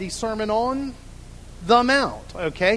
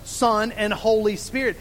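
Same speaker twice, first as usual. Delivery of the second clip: usual speaking voice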